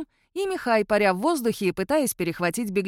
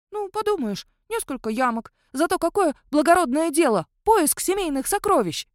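воодушевлённо; 7 semitones higher, 3 dB louder than usual. Acted. Волколак оправдывается, воодушевлённо.

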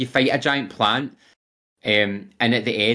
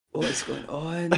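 high, soft, drawn-out bafflement; mock bewilderment; 7 semitones higher, 6 dB quieter, slower than usual. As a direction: act out a helplessly baffled player. Acting out the baffled player's reaction.

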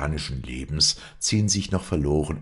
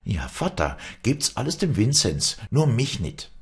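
put-on higher, proud young woman; defiant daughter; 6 semitones higher than usual. A narrator's reading. Wally refusing her father, higher defiant voice. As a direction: defy him, almost mocking.

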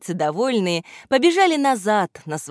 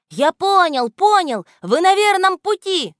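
high, louder excited cry; excited exclaim; 12 semitones higher, 6 dB louder than usual. A narrator's reading. Gramoteyka cries out to the children: high excited exclamation. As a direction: burst out with the discovery. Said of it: "воскликнул Грамотейка".

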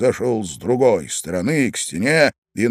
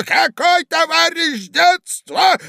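very high, slow outraged yell; old man shouting; 4 dB louder, slower than usual. A narrator's reading. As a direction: bellow in drawn-out disbelief. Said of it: "кричал старик". (возмущённый крик старика)

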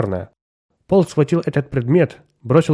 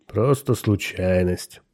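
slow, low and soft, unimpressed; phlegmatic, unhurried dismissal; 4 semitones lower, 5 dB quieter, much slower than usual. A narrator's reading. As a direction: drag it out with no interest at all. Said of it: "флегматично заметил незнакомец".

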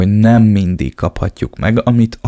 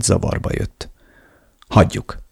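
ordinary delivery

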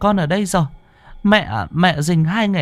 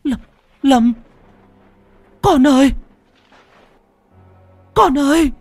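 high, loud cries for her lost son; desperate calling out; 4 dB louder than usual. A narrator's reading. Mother crying out to her dead son in the storm, loud and high. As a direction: call out to him, voice straining high. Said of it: "Cô ú ớ".

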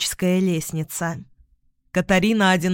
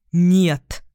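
short, louder check; firm refusal; 5 dB louder than usual. A narrator's reading. Вик stops Тиона from stepping in, firmly. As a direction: stop them firmly. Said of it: "остановил её Вик".

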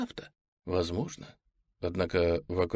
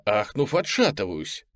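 ordinary delivery, nothing marked

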